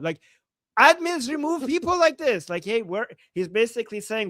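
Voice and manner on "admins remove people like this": angry demand. Retold or imitated. Voicing angry commenter.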